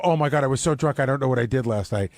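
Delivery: normal talking voice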